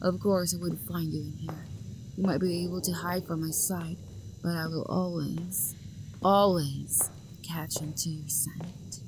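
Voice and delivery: soft, creepy whisper; hushed, possessive stalker whisper; menacing whisper. Acted. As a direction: breathe it like a threat right beside them. Stalker gloating close by.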